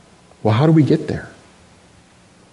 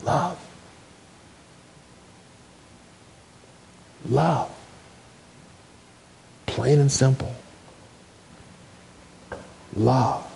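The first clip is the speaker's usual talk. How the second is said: soft whisper; 7 dB quieter, slower than usual. Retold or imitated. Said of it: "And then I heard a whisper".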